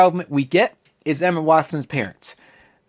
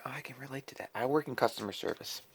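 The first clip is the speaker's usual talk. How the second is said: quiet aside; 15 dB quieter, faster than usual. Retold or imitated. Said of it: "I'm like".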